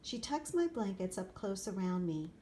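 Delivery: ordinary delivery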